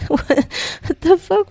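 slow, high what the fuck; drawn-out shocked disbelief; stunned disbelief. Real, not acted. Reacting to drunk wife's confession.

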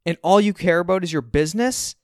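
high, loud challenge; heated, incredulous question; indignant accusation. A narrator's reading. Son accusing his dad.

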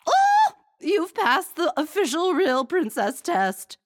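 very high, loud, slow squeal of glee; queen squealing with delight; gleeful squeal. A narrator's reading. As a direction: squeal it with relief and joy. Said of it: "the queen squealed with relief and glee".

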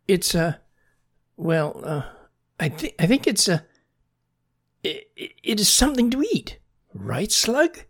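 high, halting stammer that speeds up; flustered frog. A narrator's reading. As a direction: stumble through a bluffed answer. Frog bluffing, stammering an answer.

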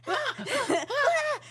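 high, loud "ah" bursts with laughter; acted emoji cries. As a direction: cry out short ah's like a cartoon face. Acting out the emoji faces.

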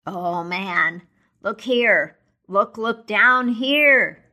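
urgent, slow look down here; ghost exclaiming. Acted. Ghost urges Scrooge to see the children, slow and insistent. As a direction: press Scrooge to look, drawing it out. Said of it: "exclaimed the ghost".

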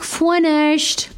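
high, drawn-out mock voice; mock partner. Her partner's safe word read out, high and long. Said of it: "he wrote back".

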